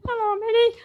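very high, squeaky Disney-style greeting; mock insane cheer in a tiny falsetto; happy cartoon character. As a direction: parody a relentlessly happy cartoon mouse.

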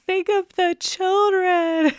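high, drawn-out mock wail; panicked mother. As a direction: imitate a woman pleading in a very high voice. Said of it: "And this woman is like".

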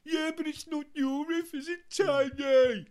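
high loud retort; mock shout. [name] arguing with [name], shouted. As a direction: snap back high and loud. Band argument acted.

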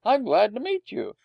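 high-pitched, delighted greeting; pleased surprise. A narrator's reading. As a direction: greet a kindred spirit eagerly. Delighted dog greets fellow mongrel.

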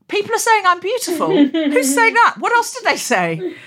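high, loud, thrilled disbelief. Retold or imitated. Guest retelling her reply to a journalist, thrilled and high. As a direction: squeal it like flattering news just landed. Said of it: "And I said".

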